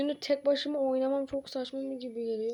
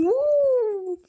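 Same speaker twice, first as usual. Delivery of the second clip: excited whoop; 9 semitones higher, 7 dB louder than usual. Real, not acted. Hyped cheer starting the game.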